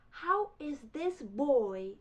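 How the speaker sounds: loud, high, slowly stretched disbelief; hammed-up indignant amazement, word by word; incredulous. Rival learner stunned by the boy's fluency, incredulous.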